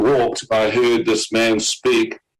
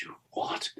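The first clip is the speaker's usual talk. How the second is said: whisper; 17 dB quieter than usual. Retold or imitated. Inner thought at refusing car owner.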